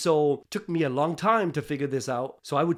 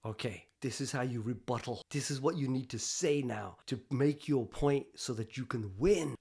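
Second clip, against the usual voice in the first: hushed, lower inner-voice coaching; 5 semitones lower, 9 dB quieter than usual. Retold or imitated. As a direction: coach yourself under your breath. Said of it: "I hear this voice".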